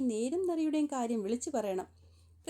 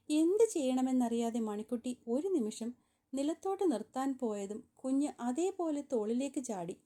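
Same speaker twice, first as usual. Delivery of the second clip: ordinary delivery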